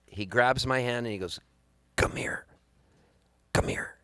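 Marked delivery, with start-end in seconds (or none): 2.0-4.0 whispered last words of a dying father. Retold or imitated. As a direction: whisper it weakly, as from a deathbed. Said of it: "His final words to me".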